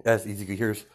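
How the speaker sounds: ordinary talk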